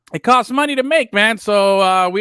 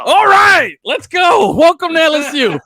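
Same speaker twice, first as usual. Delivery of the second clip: higher, louder hammed-up welcome; mock hype shout; 11 semitones higher, 5 dB louder than usual. Host imitating coach greeting recruits, mock shout. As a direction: yell it like an overeager coach. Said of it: "mock coach welcoming recruits".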